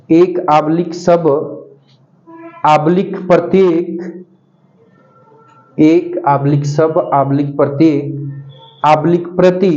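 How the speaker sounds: words spaced out slowly for copying; slow dictation. Acted. Teacher dictating notes to pupils, slow and spaced. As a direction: dictate each phrase slowly so pupils can write.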